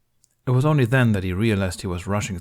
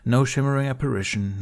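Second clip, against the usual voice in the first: ordinary delivery, nothing marked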